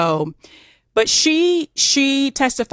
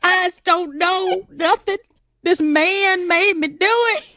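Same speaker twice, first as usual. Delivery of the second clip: much higher, whimpering mock Southern plea; hammed-up Southern helplessness; 11 semitones higher than usual. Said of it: "playing it up".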